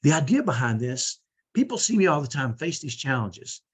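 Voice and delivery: ordinary talk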